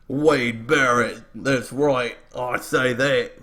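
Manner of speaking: slow, hammed-up fake English accent that slides toward Australian; bad English accent. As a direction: play Wade Barrett in a badly faked English accent, laughing. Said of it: "Wade Barrett's Australian".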